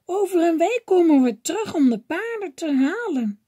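high, loud call after him; old women calling back as they leave. A narrator's reading. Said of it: "ze riepen tegen Paul".

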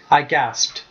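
ordinary delivery, nothing marked

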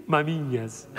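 mamiñas said softly, a shy low aside; Portuguese word. Shown as a demonstration. Comedian giving the audience the Portuguese word. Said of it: "En portugués".

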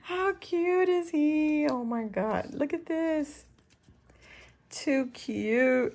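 high, drawn-out coo of delight; gushing. Real, not acted. Crafter showing viewers a cute paper clip, gushing.

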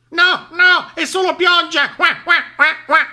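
very high, loud squawky duck; quacking duck. A narrator's reading. Duck tells the sheep it is only rain, high squawking. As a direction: squawk the correction, quacks and all. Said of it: "le informò Papera".

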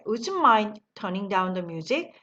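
"the" nearly swallowed after "mind". Shown as a demonstration. Teacher showing how to swallow "the" in the phrase. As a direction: slur "mind" into the next word, dropping "the". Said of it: "들을 그냥 먹어버리시면 돼요".

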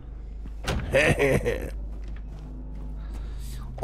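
soft, drawn-out chuckle; laugh. Real, not acted. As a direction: chuckle quietly at your own joke. Laughs at own joke.

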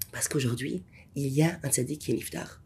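low, soft confiding answer; hushed reply. Retold or imitated. Guide answering the rabbi, hushed and low. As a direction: drop the voice to a hush.

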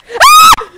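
very high, loud scream; sudden shriek of fright; startled scream. Real, not acted. Player startled by a creature in the game, screaming. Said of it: "I screamed".

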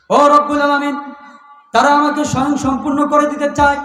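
high, loud chanted lament; impassioned preaching plea. Acted. Preacher voices a fervent plea.

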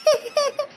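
high wailing laugh. Acted. Wife breaks into a lament.

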